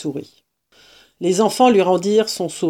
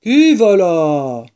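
slow, loud challenge from above; big, drawn-out sentry call; big deep voice; 5 dB louder, much slower than usual. Acted. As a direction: boom it out like a big gruff stranger. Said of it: "une grosse voix grave".

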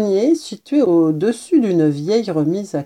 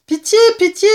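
high, louder mouse crying for mercy; pleading cry; 13 semitones higher, 5 dB louder, faster than usual. A narrator's reading. Mouse begs the cat for pity, pleading cry. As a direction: cry out high-pitched, begging. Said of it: "s'écria la souris".

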